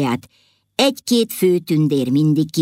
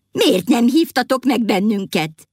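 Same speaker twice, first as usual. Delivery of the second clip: crowd shouting; 7 semitones higher than usual. A narrator's reading. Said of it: "kérdezték kiáltozva".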